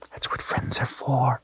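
soft whisper in the ear. A narrator's reading. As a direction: whisper it tenderly. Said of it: "he whispered in her ear".

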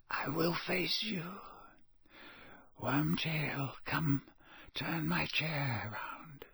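slow, soft menace sinking to whisper; hushed and drawn out, long pauses; cold, barely audible. A narrator's reading. Villain revealing himself and ordering his servant, barely audible. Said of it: "barely audible now".

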